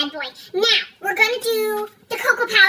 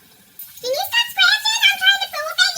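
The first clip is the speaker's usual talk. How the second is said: high scolding voice; 10 semitones higher than usual. Real, not acted. Scolding pet while cooking.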